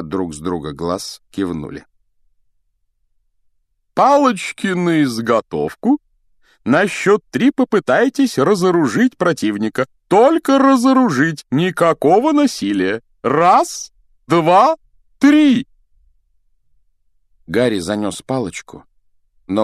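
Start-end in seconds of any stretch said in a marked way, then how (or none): 3.9-15.7 high, ringing instructions building to a shout; loud commanding. A narrator's reading. Duel master starting pupils' practice fight, loud commanding. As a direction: call orders out, climbing on the count.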